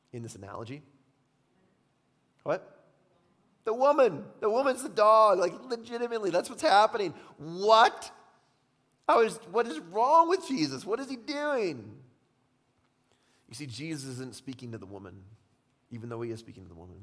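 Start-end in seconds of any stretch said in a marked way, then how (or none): 2.4-11.9 mock outrage. Preacher feigning outrage.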